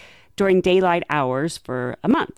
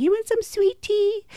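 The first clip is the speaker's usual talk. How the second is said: put-on higher, sugary decoy girl. Decoy girl offering the predator tea, high sugary voice. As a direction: lure him in, sweetly, much higher. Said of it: "she'd be like".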